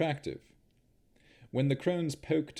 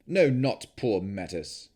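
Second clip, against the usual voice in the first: slow, louder, cutting denial; firm rebuke; 7 dB louder than usual. Acted. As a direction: shut her pity down hard. Strahd cuts her off sharply.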